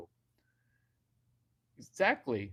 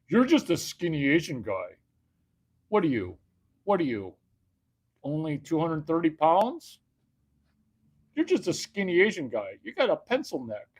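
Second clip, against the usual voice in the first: higher, louder sneering heckler voice; mocking heckler; 4 semitones higher, 5 dB louder than usual. Beta males calling him skinny, sneering higher and louder.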